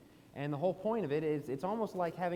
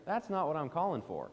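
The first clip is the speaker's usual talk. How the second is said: usual speaking voice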